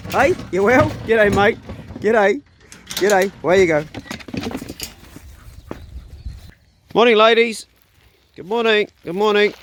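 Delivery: greeting the chickens in the morning, high and loud; cheery animal greeting. Real, not acted. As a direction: beam a bright hello at the flock.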